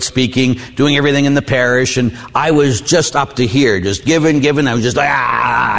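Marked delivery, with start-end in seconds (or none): 5.1-5.8 drawn-out overwhelmed "aah"; exasperated groan. Retold or imitated. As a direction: let out a worn-out cry. Retells being at breaking point.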